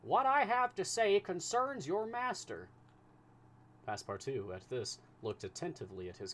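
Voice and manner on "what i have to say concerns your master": high, loud, slow insistence; urgent command. A narrator's reading. Fix commands Passepartout to stay, loud and urgent. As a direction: pin him in place with the words.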